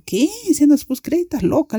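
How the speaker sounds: high-pitched, incredulous question; retold disbelief. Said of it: "Y yo". (incredulidad actuada, en tono agudo)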